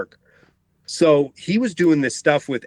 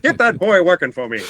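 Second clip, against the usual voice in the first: put-on old-time mid-Atlantic accent, a little higher; 4 semitones higher than usual. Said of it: "There's that mid-Atlantic accent again".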